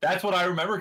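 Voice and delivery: ordinary talk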